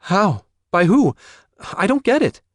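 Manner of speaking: high, loud, rapid questions; frantic to understand; shocked disbelief. Acted. Daughter shocked at murder news.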